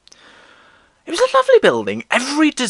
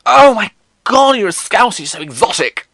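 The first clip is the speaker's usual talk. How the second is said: high, loud mock-impressed gushing; mock excitement; 11 semitones higher, 7 dB louder than usual. People thrilled at a Scouse accent, mock excitement. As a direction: gush loudly like thrilled classmates, exaggerated. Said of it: "People were like".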